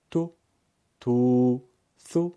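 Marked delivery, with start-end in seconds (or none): 1.0-1.6 تو held long and louder; long u, two beats. Shown as a demonstration. Teacher demonstrates long تو for pupils. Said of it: "دو برابر بکشیم".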